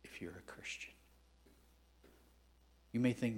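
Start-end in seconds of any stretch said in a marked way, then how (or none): 0.0-0.9 hushed. Shown as a demonstration. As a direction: drop to a whisper for emphasis.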